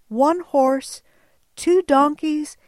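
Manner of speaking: ordinary talk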